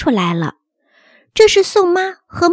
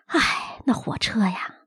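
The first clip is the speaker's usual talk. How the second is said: hushed, breathy sigh of regret; mild sigh; 6 semitones lower, 8 dB quieter, slower than usual. A narrator's reading. Nanny recalls the lost children to the mother, sighing softly. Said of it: "两个人唉声叹气的".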